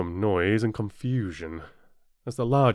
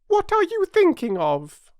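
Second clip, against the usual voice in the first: high, loud mouse reproach; severe; 7 dB louder than usual. A narrator's reading. Mouse demanding Alice's attention, severely. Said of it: "said the mouse to Alice severely".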